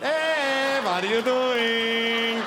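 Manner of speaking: sing-song friendly call, grotesquely casual; mock casual Nazi.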